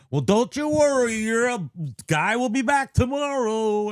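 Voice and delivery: high, mock-soothing imitation of the carrier; mocking the backup carrier. Wife mimics the dismissive mail carrier, high and patronizing. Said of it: "he said one time".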